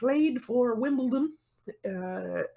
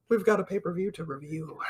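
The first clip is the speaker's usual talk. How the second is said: ordinary delivery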